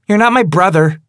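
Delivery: high, loud, biting retort; spat out. Acted. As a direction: spit the words back at him. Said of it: "she spits out".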